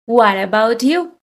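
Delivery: normal talking voice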